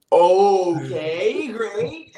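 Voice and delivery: drawn-out, rising okay, unconvinced; stretched-out dubious agreement; skeptical okay. Retold or imitated. Wary reply to unbelievable news.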